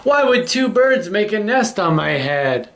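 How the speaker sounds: high, incredulous question; worried elephant protest. Acted.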